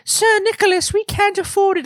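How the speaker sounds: very high, loud falsetto plea; hammed-up begging in a tiny high voice; mock pleading peasant. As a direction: play a poor taxpayer begging in squeaky falsetto. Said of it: "And it's like".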